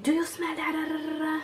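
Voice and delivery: high, mocking mom voice; imitating mom. Mom going on about the smell, mocking high voice. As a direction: rattle on high like a nagging mother. Mom's nagging, voiced in story.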